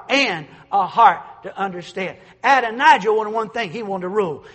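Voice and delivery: loud, high-pitched pulpit preaching; preaching at full force. Acted. Pastor preaching to the congregation, full force. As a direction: preach it out with rising fervor.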